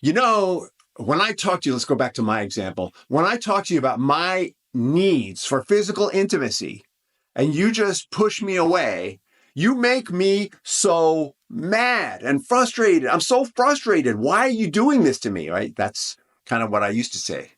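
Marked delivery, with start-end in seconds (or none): 0.0-1.7 accusing complaint. Shown as a demonstration. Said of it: "our natural tendency as human beings is to say". 3.1-15.5 hammed-up frustrated accusation at a partner; frustrated blaming. Shown as a demonstration. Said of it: "So you can hear the difference between those two".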